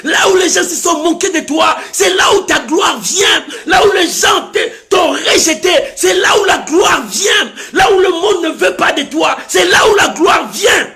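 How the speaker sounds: high, loud, driving sermon cry; fervent preacher shouting encouragement to the congregation; full-force preaching. Real, not acted. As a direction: preach it as a rising, repeated proclamation.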